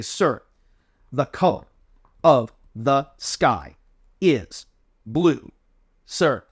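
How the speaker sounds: each word set apart by a pause, slow; pause after every word. Shown as a demonstration. Cadet answering a short question, pausing after every word. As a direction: break it word by word. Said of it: "we're gonna punctuate every word".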